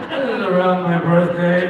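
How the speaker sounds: loud laughing delivery. Real, not acted. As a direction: laugh through the words. Laughing at own journal.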